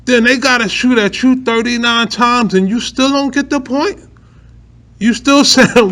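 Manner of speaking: high, rising disbelief; asking in amazement; can't-believe-it question; incredulous. Real, not acted.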